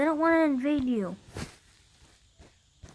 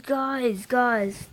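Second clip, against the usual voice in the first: usual speaking voice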